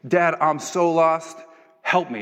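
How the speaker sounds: put-on stretched-out lost teen plea; panicked plea. Teen calling her dad while lost, slow panicked plea.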